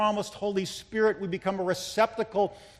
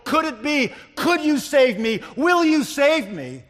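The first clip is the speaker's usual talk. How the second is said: high, loud imploring questions; urgent pleading; 8 semitones higher, 9 dB louder than usual. Acted. Preacher voicing the sinner begging the scarred hand, urgent plea. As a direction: plead with desperate hope.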